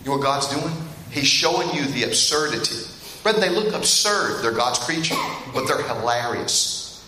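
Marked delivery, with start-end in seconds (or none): none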